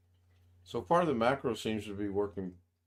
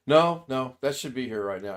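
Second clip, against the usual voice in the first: insistent mom; 4 semitones higher, 8 dB louder than usual. Retold or imitated. Mom insisting food is late.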